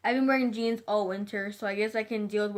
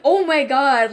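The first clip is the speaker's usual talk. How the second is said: loud, alarmed outburst; retold panic; 3 semitones higher, 11 dB louder, slower than usual. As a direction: blurt it out loudly, acting out alarm. Retold panic at being chased.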